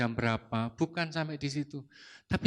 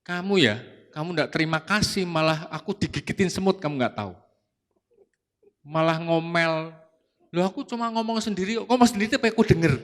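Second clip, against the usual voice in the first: indignant scolding; 3 semitones higher, 6 dB louder than usual. Retold or imitated. Retold quarrel with his wife.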